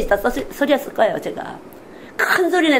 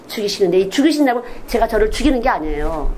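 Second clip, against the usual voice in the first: ordinary talk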